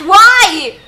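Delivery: a loud, disbelieving yell; loud outcry. Real, not acted. Streamer recoiling from a corpse in the game, loud yell. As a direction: shout it in disgust. Reacting to gross game scene.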